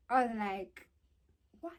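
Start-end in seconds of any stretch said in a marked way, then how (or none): 1.6-1.7 short understated "what"; low-key disbelief. Retold or imitated. Storyteller reacting to sounds from outside, low-key disbelief. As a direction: let it slip out quietly. Retold reaction, first take.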